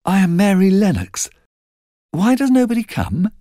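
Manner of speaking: put-on a small girl demanding attention; child Mary. A narrator's reading. Mary asking the soldier, high childlike voice.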